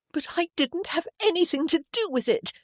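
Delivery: very high-pitched, indignant plea of innocence; whining protest. A narrator's reading. Sister told to fix it, whining protest. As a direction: wail it like a wronged child.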